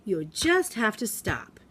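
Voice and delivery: loud sharp "Dude", then a slow, deliberate command; teacher halting a rambling student; firm teacher stop. Retold or imitated. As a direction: call him out, then lay it down slowly. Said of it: "And I go".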